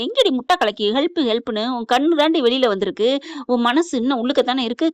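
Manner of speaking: ordinary delivery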